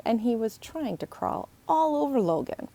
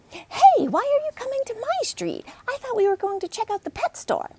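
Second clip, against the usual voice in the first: put-on much higher, loud girl calling out; a bossy girl hollering across the street; Emily's voice; 5 dB louder than usual. Emily confronts Logan on her street, high and loud.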